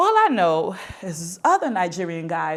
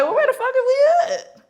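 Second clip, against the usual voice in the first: very high, loud comic squawk; startled alarm; 7 dB louder than usual. Retold or imitated. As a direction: yelp it in shocked confusion. Startled reaction, retold.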